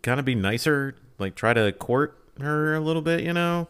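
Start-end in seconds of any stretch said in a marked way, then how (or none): none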